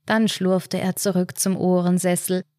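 ordinary delivery, nothing marked

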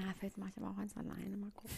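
hushed conspiratorial whisper. Acted. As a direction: keep it a whispered secret. Continues the secret whisper.

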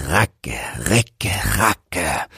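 racke, recke whispered softly; whispered mill sound. Acted. Mill noise whispered after spoken take.

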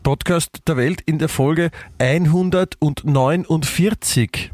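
upbeat show-opener announcement; announcer voice. Acted. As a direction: announce the episode like a host. Announces episode number.

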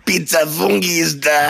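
high, fake French pizza man; fake French accent. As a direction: ham up a mock French accent. Mocking the pizza man again.

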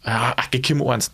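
ordinary talk, nothing marked

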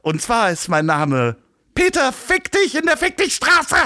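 squeaky mocking put-on voice; disguised voice. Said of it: "immer mit verstellter Stimme".

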